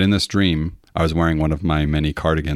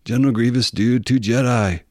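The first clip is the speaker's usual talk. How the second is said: surfer voice; 7 semitones higher than usual. Retold or imitated. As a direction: play the alien as a surfer dude. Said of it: "he talks like a surfer".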